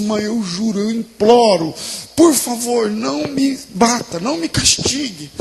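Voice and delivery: high, anguished plea, slowing on the words; imploring. Acted. Kneeling boy implores his mother not to beat him, pleading.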